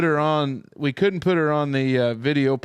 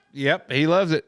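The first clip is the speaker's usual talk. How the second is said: ordinary delivery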